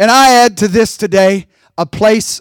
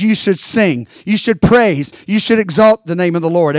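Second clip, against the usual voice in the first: ordinary delivery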